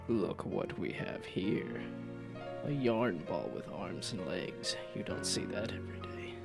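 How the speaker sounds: low, slow gravelly rumble. Acted. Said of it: "A gravelly voice rumbles out".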